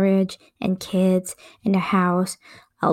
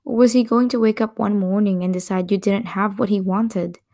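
ordinary delivery, nothing marked

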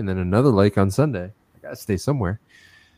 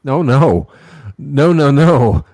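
louder, higher repeated no; a raised, teasing denial; playful protest; 4 semitones higher, 6 dB louder, slower than usual. Real, not acted. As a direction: protest laughingly, loud.

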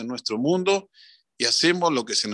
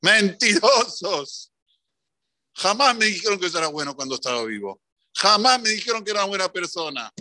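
Jaime indignant; 5 semitones higher than usual. Retold or imitated. Dead man rises and scolds the mourners, mild mock indignation. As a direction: call them out as liars. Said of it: "¿Y qué dijo Jaime?".

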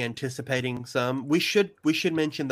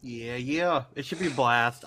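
usual speaking voice

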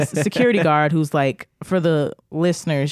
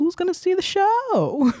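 higher, sly mock voice; mock sly man; slower than usual. Imagining the man alone in his room, higher mock voice. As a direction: play him as a pleased schemer.

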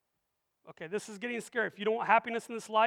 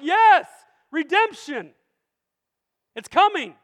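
excited; 3 semitones higher, 7 dB louder, slower than usual. Retold or imitated. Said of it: "I'm like".

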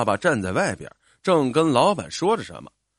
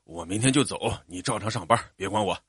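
lightly voiced dad, lower and softer; hasty father; 5 semitones lower, 5 dB quieter than usual. A narrator's reading. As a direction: wave the offer away in a lower voice. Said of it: "爸爸连忙说". (低声连忙推辞的父亲)

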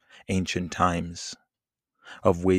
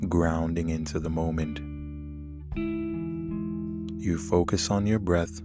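calm and soothing over the music. Acted. Leads a guided meditation.

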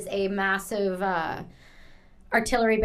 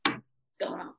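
whispered; 10 dB quieter than usual. Shown as a demonstration. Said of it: "It's like".

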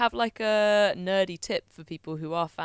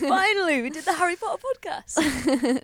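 higher and louder, triumphant; excited cheer; 10 semitones higher, 8 dB louder than usual. Real, not acted. Co-host celebrating finally finishing the podcast, excited cheer. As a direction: cheer it like a long-awaited win.

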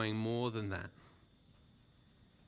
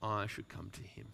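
softer, lower, reflective; soft repeat; 3 semitones lower, 6 dB quieter than usual. Acted. Preacher quietly repeating the promise. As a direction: let it fall gently.

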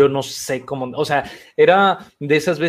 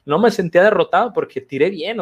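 usual speaking voice

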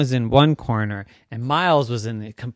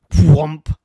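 loud "whoomp" impact; falling thud noise; 9 dB louder than usual. Acted. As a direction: imitate a heavy landing with the voice. Imitates cube hitting the ground.

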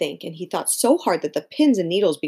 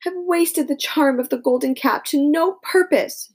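put-on high, slow, disappointed girl voice; a girl about to cry; near tears; 9 semitones higher than usual. Dorothy laments the wasted cap, slow and near tears. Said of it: "ready to cry with disappointment".

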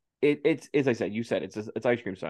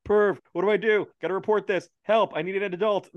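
high-pitched mock alarm; hammed-up cry for help; mock panic; 8 semitones higher than usual. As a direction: imitate someone panicking at a pervert. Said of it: "that's what he says".

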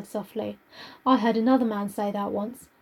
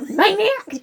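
laughing, higher; 8 semitones higher, 5 dB louder than usual. Real, not acted. Teasing her co-host with a laugh, laughing. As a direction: laugh the word out.

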